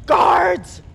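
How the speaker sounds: loud, high call for the guards; shouted call. Acted. Queen shouts for her guards. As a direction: bark the summons.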